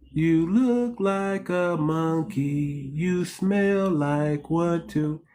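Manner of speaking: lightly sweet voice, slow; a hint of a sugary teacher taunting; sweet cruel teacher. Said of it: "See how sweet that lady sounded?".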